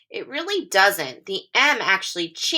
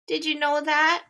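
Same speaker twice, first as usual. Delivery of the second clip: usual speaking voice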